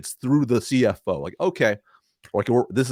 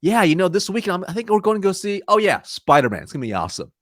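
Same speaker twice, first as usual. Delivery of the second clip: casual chatting; 8 semitones higher, 4 dB louder than usual. Shown as a demonstration. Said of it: "when they see you say".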